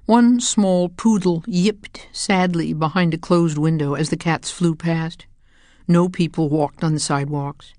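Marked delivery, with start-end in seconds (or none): none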